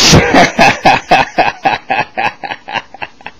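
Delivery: loud real laughter; hearty laugh. Real, not acted. Comedian after his punchline, loud hearty real laugh. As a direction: crack up at your own punchline.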